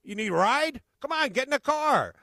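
high loud eager invitation; eager offer. Retold or imitated. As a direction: urge him into the car. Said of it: "he goes".

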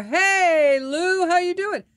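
loud, high imitated holler; yell across the bar. Talkative man interrupting her to hail a buddy, yelling. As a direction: holler over everyone's heads to a buddy. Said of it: "he would yell across the bar".

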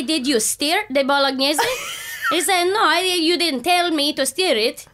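hamming up the passionate Italian therapist telling her story; Italian therapist impression. Retelling her Italian therapist's bolognese story in her voice.

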